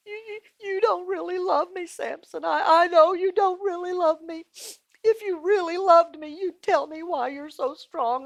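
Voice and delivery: high, whimpering mock cry; Delilah. Delilah pleading with Samson for his secret, fake-crying. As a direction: sob it out in a put-on, high voice. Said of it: "and, and she's crying".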